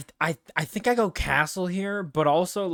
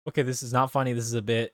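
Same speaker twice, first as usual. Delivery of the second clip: ordinary talk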